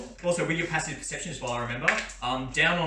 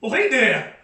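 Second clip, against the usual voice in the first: goofy yokel; 10 semitones higher, 10 dB louder than usual. Acted. Guard Cletus spots the party's ox, excited, high laughing yokel. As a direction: play the dim guard who just spotted an ox and wants to pet it. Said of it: "he looks up and he's like".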